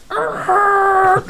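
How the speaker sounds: high, loud mock tyre screech; mimicking a car skidding to a stop; screeching brakes imitation. Imitating car braking hard.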